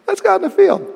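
high, falsely friendly invitation; mock-innocent high voice of Cain; scheming Cain. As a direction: lure him out sweetly with a high voice. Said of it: "he says to his brother Abel".

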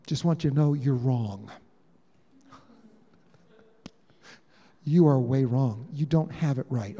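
low, insistent rebuke; firm correction. Retold or imitated. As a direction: set him straight in a low voice. Peter privately rebukes Jesus.

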